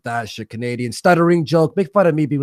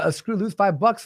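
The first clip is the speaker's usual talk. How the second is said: ordinary talk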